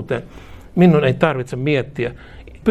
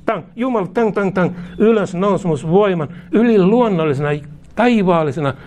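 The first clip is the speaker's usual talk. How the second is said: higher, rising charismatic prayer burst; fervent preaching; 4 semitones higher than usual. Acted. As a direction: call down the power with fervour. (korkea, kiihkeä saarnahuuto)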